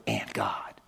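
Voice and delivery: whispered, almost unvoiced. Preacher hushing the final words to a whisper. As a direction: let it fall to a breath. Mocking declaration ends in hush.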